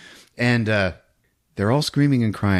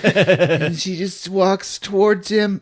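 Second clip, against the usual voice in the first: usual speaking voice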